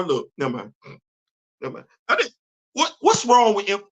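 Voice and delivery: usual speaking voice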